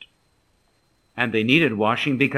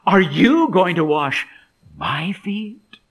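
high disbelief dropping to whispered "my feet?"; surprised; 3 semitones higher, 4 dB louder, slower than usual. Acted. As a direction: start incredulous, pause, finish in an airy rising whisper. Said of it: "You notice Peter's surprise".

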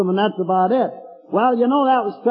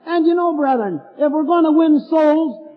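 preaching; 6 semitones higher than usual. Real, not acted. Preacher exhorting the congregation, raised voice. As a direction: exhort the brethren.

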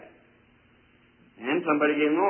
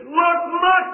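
high, loud cry of "look"; retold wife's scream; 8 dB louder than usual. Wife screams to husband about their boy, imitated scream. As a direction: call out like a startled wife, much higher. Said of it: "heard his wife give a scream".

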